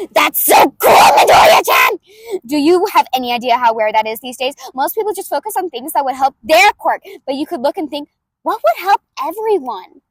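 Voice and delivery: put-on brash inventor girl, throaty and loud; loud brutal May voice. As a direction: bulldoze him with gleeful energy. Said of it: "I do it in the back of the throat, and I do it loud".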